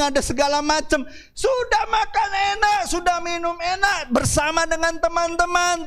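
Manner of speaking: high, driving preacher's delivery; full-force preaching. Real, not acted. Preacher recalling wedding feasts to the congregation, in full-force preaching.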